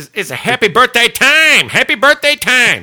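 high, loud put-on voice; Ding Pong voice. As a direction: belt it out in a goofy character voice. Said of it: "in the voice of Ding Pong".

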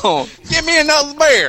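high loud yokel holler. Acted. Mock redneck voice.

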